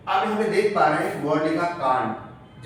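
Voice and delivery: ordinary talk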